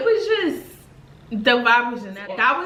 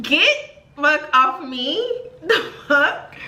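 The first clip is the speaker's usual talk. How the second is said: laughing mock rudeness; 6 semitones higher, 3 dB louder, much slower than usual. Real, not acted. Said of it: "He love when I talk like that".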